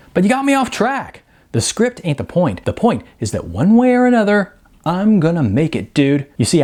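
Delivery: Sean's voice. A narrator's reading. Sean determined.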